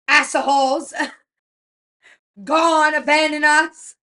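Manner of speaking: loud, high mock outrage in broken English; hammed-up scolding in broken English. Mother-in-law cursing the staff who abandoned them, loud and broken English. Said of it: "in her broken English, will go".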